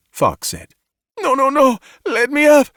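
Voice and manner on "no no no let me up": high, loud frantic shout; pinned woman shouting in panic. A narrator's reading. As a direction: fight the sedation with a cry. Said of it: "Xi shouted".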